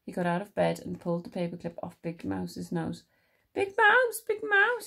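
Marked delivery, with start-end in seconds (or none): 3.5-4.9 high, loud mouse call; crying out, Little Mouse. A narrator's reading. Lonely Little Mouse wakes Big Mouse, high crying out. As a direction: cry the name out in a squeak. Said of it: "he cried".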